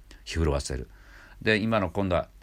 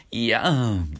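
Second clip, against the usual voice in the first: nasal いやん; 4 semitones higher, much slower than usual. Shown as a demonstration. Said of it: "鼻に抜けるんですよ".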